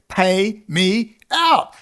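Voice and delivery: drawn-out, very slow pay me out; each word stretched with weary contempt; slow demand. Real, not acted. Venting at those who pay others, slow contemptuous demand.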